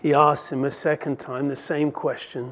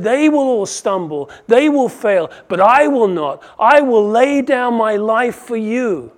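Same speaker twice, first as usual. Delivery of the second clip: loud, proud vow, higher and louder; boastful claim; 7 semitones higher, 11 dB louder than usual. Retold or imitated. Preacher acting out Peter's brash vow, loud and boastful. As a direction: thump the chest, swear he'll never fail.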